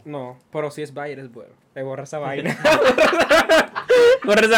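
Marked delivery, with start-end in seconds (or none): 2.6-4.2 laughter. Real, not acted. Laughs at Bayer joke.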